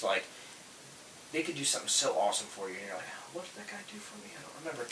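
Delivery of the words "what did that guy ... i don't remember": high, very soft musing; small, high-pitched puzzled mumble; vague recall. Retold or imitated. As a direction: wonder it quietly to yourself. Mimics vague recall of good customers.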